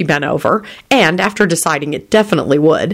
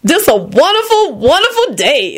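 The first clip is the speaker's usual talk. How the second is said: high, drawn-out delight; ecstatic; 11 semitones higher than usual. A narrator's reading. Lucretia arriving at the Palace, high and ecstatic. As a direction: soar on each wonderful. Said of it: "Lucretia repeated ecstatically".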